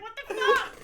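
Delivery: laughing. Retold or imitated. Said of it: "I'm like".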